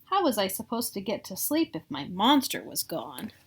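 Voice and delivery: plain reading voice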